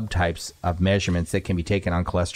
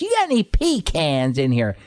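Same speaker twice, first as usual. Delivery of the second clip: customer repeating himself; 8 semitones higher than usual. Retold or imitated. Customer asking grandfather for pecans, high exaggerated voice. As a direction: put on the stranger's high, exaggerated voice. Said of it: "He goes".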